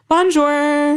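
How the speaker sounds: put-on high, bright-eyed Bonjour with a heavy American accent; American French accent. Newcomer in France greeting with an American accent. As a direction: say "Bonjour" like a fresh American arrival. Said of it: "to really nail the French accent".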